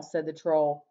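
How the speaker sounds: ordinary delivery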